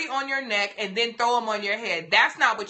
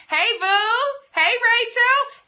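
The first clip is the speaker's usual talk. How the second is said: high, loud call-out to arriving viewers; real burst of welcome, pitched way up; excited greeting; 11 semitones higher, 6 dB louder, slower than usual. Real, not acted. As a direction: sing out a delighted hello, stretching the name. Greets viewers joining the live stream.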